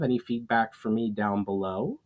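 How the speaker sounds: usual speaking voice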